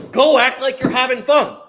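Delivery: high, loud burst of encouragement; emphatic urging. Real, not acted. Coach telling viewers to go have fun, loud urging.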